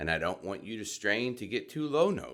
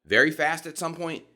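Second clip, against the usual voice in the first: mock fast talk; 5 semitones higher, 5 dB louder than usual. Shown as a demonstration. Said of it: "some people will talk very fast".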